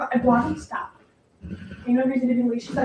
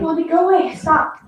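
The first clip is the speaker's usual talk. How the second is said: louder, higher scolding of the dog; firm scold; 5 semitones higher, 6 dB louder than usual. Real, not acted. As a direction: push the dog off with a sharp raised voice. Shooing the dog away.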